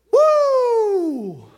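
high, loud whoop of delight; joyful woo. Retold or imitated. Pastor stepping from his truck into perfect weather, loud joyful whoop. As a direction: let out a happy whoop. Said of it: "and I said".

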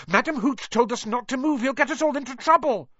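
very high, urgent protest; shouted warning. A narrator's reading. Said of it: "shouted Hermione Granger".

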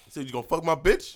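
high, loud challenge; mock confrontation. Playing a man confronting a rival, mock outraged. Acted confrontation to set up joke.

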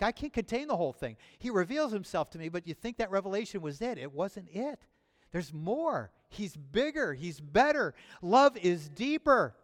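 high, loud, rising sermon climax; full-force preaching. Acted.

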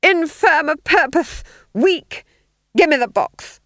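high, loud, lisping tirade; screaming Duke. A narrator's reading. Duke snatching matches from fool, high angry shout. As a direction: berate him at high pitch. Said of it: "he screamed".

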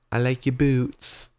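bad Australian accent. Cashier complimenting boots, badly imitated Australian accent. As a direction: do a poor Australian impersonation, drawn out. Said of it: "that was the worst accent ever".

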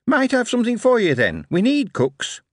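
put-on high chirpy young clerk; bright, quick recruiting officer; high eager Billy voice. Billy speaks.

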